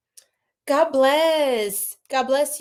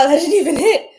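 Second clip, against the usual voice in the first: laughing; 3 semitones higher, 8 dB louder, faster than usual. Real, not acted. Laughs at the bell.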